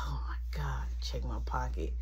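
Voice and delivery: hushed unvoiced whisper; panicked whisper so nobody hears; whispering as the phone vibrates. Phone buzzing in the dark.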